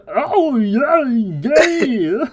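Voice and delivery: high, laughing dopey oh yeah; mocking a dumb thug's voice, high and giggly. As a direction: play a dim henchman, grinning. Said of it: "He's like".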